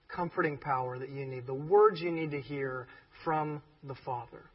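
ordinary talk

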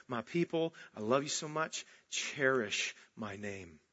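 soft and low, slowing down on cherish; hushed loving plea; tender voice of God. Acted. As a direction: speak it gently as a loving father. God's voice tender in sermon.

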